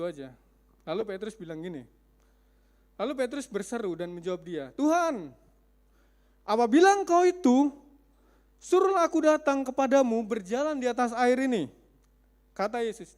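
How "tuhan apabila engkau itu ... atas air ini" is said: calling out. Acted. Peter calls out to Jesus.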